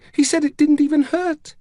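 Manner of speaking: put-on high child, trembling with distress; very high-pitched, tremulous insistence. A narrator's reading. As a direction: protest it as a shaken little girl. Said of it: "her voice trembling".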